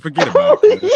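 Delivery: high, loud, laughing repeat; laughing through it. Retold or imitated. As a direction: crack up while saying it. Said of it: "I said".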